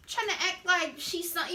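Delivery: normal talking voice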